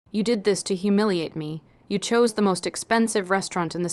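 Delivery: ordinary delivery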